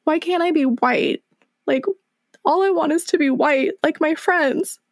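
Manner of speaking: a hint of a tearful fifth grader pleading; crying child. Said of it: "crying to my mom and just saying".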